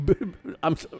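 stammering, loud flustered wizard. Retold or imitated. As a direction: fumble the words in a panic.

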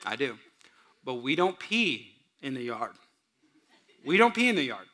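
put-on calm the little boy down lovingly; a father gently teaching his five-year-old son; gentle father. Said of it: "I said".

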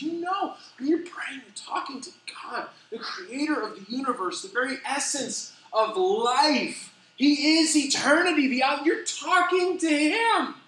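high, slow, earnest plea; a hint of a weeping preacher; tearful preacher. Francis Chan weeping on stage about God, earnest. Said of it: "starts weeping on the stage".

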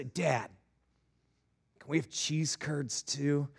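lightly voiced kid's plea; asking child. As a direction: ask dad timidly for a treat. Voicing child asking dad.

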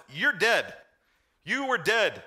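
loud, high, hammered "You're dead"; emphatic declaration. Acted. Pastor voicing Paul to Gentiles, loud and emphatic. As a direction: pound each word like a verdict.

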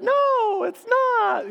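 put-on squeaky high little-boy voice; high kid voice. Kid on the phone insisting he's not the woman. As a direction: pitch way up like a small child.

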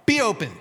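"Be open" said short and firm; firm command. Shown as a demonstration. Preacher modelling the right prayer, firm and short. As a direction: clip it out like an order.

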